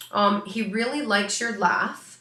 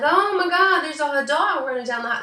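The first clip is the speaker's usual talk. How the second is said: startled bystander; 8 semitones higher, 5 dB louder than usual. Retold or imitated. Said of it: "people would be like".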